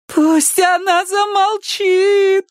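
very high, slow howl; wailing. A narrator's reading. Girl begging for her sister to stop, wailing. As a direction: wail it with hands over ears. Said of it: "провыла Эллисон".

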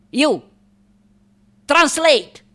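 loud, high, curt order; commanding shout; imperious command. Acted. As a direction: order the interpreter to work. Orders the interpreter.

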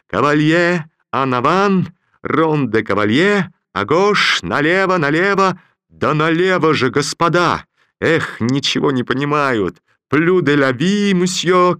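loud high ballroom calls in French; dance caller shouting. Acted. Dance master shouting figures to the dancers. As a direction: call the figures over the music. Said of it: "кричал Бабетинский".

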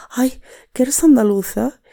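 a hint of a delighted local asking; surprised Catalan acquaintance. Imitando a catalanes. (sorpresa ligera y aguda de una vecina catalana)

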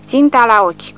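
high, loud order to aim; old sergeant to his squad, loud order; loud command. A narrator's reading. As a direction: bark it at the squad. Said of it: "comandă bătrânul".

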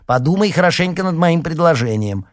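high, loud, pestering cry; called-out urging. A narrator's reading. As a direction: shout it insistently while being pushed out. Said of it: "крикнула Марина".

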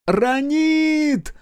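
high, loud, drawn-out call; full-throated yell. A narrator's reading. Кейла calls for missing daughter, full-throated yell. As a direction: scream the name at full volume. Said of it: "во всё горло завопила Кейла".

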